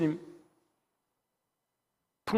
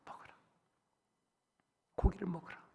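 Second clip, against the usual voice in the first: soft whisper; 11 dB quieter than usual. Acted. Hushed retelling.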